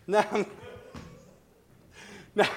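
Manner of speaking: usual speaking voice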